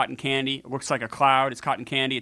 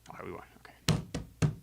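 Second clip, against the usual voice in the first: whisper; 11 semitones lower, 12 dB quieter than usual. Real, not acted. Quiet after the win.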